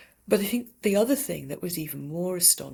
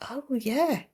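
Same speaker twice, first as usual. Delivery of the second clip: dawning realization; 6 semitones higher, 3 dB louder than usual. Retold or imitated. Sudden realization at bedside.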